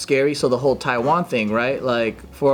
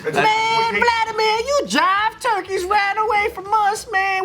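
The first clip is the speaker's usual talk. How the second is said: much higher, slow hammed-up dubbed hero; dubbed kung fu movie voice. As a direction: squeal it like a seventies kung fu dub. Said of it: "He's just quoting a movie".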